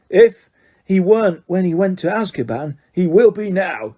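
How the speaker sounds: slow, heavy words from the driver. Acted. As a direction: let each word plod out. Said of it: "said Ern in his slow voice".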